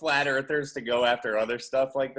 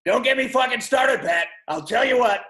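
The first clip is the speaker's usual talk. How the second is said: loud, high mock-paranoid outburst; conspiracy rant shout; 8 semitones higher, 6 dB louder than usual. Mock conspiracy theorist to Pat, ranting loudly. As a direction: yell it like a fired-up conspiracy nut.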